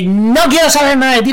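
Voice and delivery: high, loud brush-off; angry dismissal. Retold or imitated. To the girl who stood him up, loud angry brush-off. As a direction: shut her out for good.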